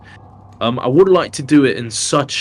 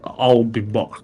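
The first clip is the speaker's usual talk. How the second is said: put-on Austrian accent; slower than usual. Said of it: "thick Austrian accent".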